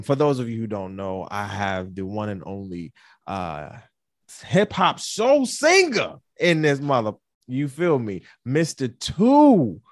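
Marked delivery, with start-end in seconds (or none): 4.5-9.8 loud, high announcer build-up; host bringing his guest on, loud and high; hype guest introduction. Acted. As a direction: call out the guest like a ring announcer.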